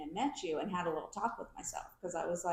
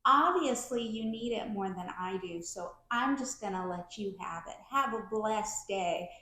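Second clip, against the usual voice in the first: put-on syrupy Southern accent. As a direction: imitate sugary Georgia Southern politeness dripping with contempt. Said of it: "syrupy, sweet Georgia Southern accent".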